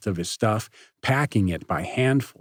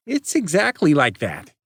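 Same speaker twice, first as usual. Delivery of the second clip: loud, sharp, higher retort; angry retort; 7 semitones higher, 4 dB louder than usual. Acted. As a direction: snap it back hard. Nate retorting.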